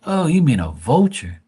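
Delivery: suddenly getting what dad meant; surprised realisation. Retold or imitated. To dad, finally realising he means vulture, surprised.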